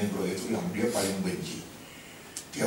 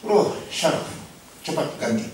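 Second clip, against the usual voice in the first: 1 angry order; 8 semitones higher, 5 dB louder than usual. Retold or imitated. Employer keeps yelling at servant.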